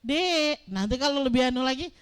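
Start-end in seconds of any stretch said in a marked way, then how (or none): 0.0-0.7 second sterner call. Shown as a demonstration. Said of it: "Nadanya udah beda".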